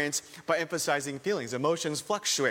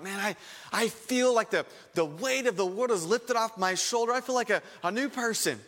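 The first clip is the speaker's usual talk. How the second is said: higher, bright-eyed, overjoyed testimony; gushing new convert; 6 semitones higher than usual. Retold or imitated. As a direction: gush like someone just saved. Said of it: "they talk".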